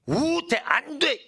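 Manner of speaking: high, drawn-out proclamation; emphatic prohibition. Acted. Preacher acting out the original text's list of bans, emphatic prohibition. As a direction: intone each ban high and forcefully.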